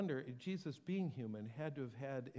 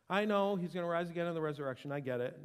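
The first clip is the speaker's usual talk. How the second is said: fast, louder brush-off; impatient Martha; 3 semitones higher, 7 dB louder, faster than usual. Retold or imitated. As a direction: hurry through it, annoyed.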